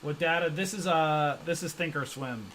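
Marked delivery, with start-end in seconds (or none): none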